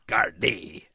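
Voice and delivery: low, gruff sailor's Gardaí; mock sailor voice. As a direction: growl Gardaí like an old sailor. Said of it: "It sounds like a sailor".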